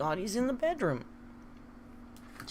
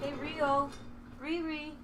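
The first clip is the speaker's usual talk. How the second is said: high, softer coaxing call; coaxing pet call; 10 semitones higher, 4 dB quieter, slower than usual. Real, not acted. Owner softly coaxes her hiding cat, sing-song. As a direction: gently lure the cat over.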